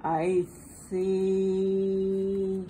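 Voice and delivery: ordinary delivery, nothing marked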